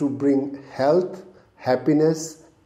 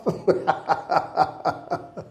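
hearty laugh; 2 semitones higher than usual. Acted. Laughs after laughter club saying.

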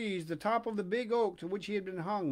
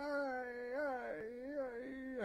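high wail fading softer; Pinocchio's despairing wail; 6 semitones higher, 9 dB quieter, much slower than usual. A narrator's reading. Puppet crying alone at the grave, high fading wail. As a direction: draw out a mock cry, dying away. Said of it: "And in his despair".

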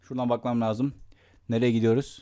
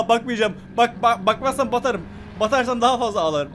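scared; 6 semitones higher than usual. Real, not acted. Scared, refuses to look.

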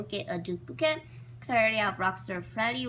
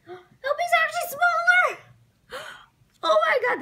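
much higher, loud astonished squeal; surprised gasp; 9 dB louder than usual. Real, not acted. Surprise at toy size.

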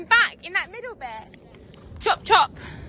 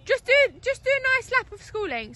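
squeaky raised voice, higher than usual; high-pitched voice; 7 semitones higher than usual. Real, not acted. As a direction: talk up high without meaning to. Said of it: "my voice sounds so high pitched".

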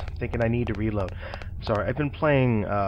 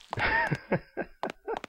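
quick run of staccato chuckles; short chuckle; 4 dB quieter than usual. Real, not acted. Gamer laughing at cheap enemies, staccato chuckle. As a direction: let out a rapid little laugh.

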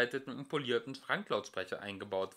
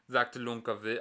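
plain reading voice

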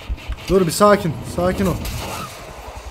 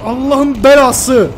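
slow, loud, higher groaning curse; drawn-out exasperated curse; 7 semitones higher, 8 dB louder, much slower than usual. Real, not acted. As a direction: stretch the curse out in frustration. Angry curse at game. (yavaş, uzatılmış, yüksek sesle öfkeli beddua)

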